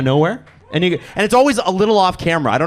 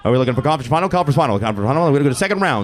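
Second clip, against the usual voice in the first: auctioneer; 5 semitones lower, much faster than usual. Pretend. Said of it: "I feel like an auctioneer".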